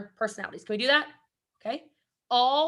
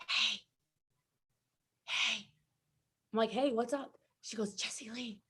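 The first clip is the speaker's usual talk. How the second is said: much softer, secretive hey; hushed call; 11 dB quieter than usual. Retold or imitated. Stenographer quietly getting Jesse Lee's attention in court, hushed. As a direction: murmur it so the court can't hear.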